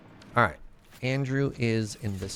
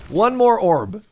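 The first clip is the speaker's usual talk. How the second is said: laughing; 9 semitones higher, 7 dB louder, slower than usual. Real, not acted. Laughing while grabbing orb.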